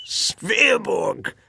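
high, strained voice of an ailing man; weak old man. A narrator's reading. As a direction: say it feebly after a coughing fit. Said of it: "he said weakly".

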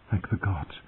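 soft whisper of relief; hushed, relieved murmur. A narrator's reading. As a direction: breathe out thanks under your breath. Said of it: "she whispered".

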